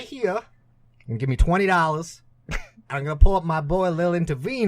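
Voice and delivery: put-on light Boston rapper accent; hustling rapper in a Boston accent. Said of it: "probably have a Boston accent".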